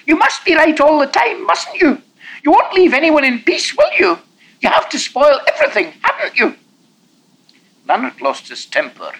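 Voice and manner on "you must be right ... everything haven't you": put-on high, loud whining child; strong whining note. A narrator's reading. Boy whining at Lanark about the walk, high and loud. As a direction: whine it out like a sulky boy. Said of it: "cried Alexander in a strong whining note".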